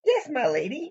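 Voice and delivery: high, mock-courtly knight; put-on formal deference; super formal. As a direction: answer like a ceremonious servant. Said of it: "He's super formal".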